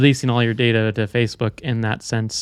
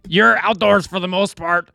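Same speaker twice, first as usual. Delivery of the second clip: put-on reading a quote in a high Brooklyn accent; 6 semitones higher than usual. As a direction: raise the pitch, thick Brooklyn accent. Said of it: "little Brooklyn accent".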